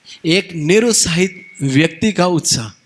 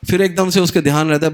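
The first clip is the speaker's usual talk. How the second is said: normal talking voice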